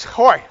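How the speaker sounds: "hoy" with an audible H at the start; Hebrew pronunciation. Shown as a demonstration. Teacher introducing a Hebraism, "hoy" begun with an H. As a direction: sound the H in "hoy". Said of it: "that's not the same word".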